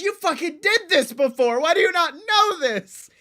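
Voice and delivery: high incredulous complaint; exasperated. Retold or imitated. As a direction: throw it back in disbelief. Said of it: "I'm like".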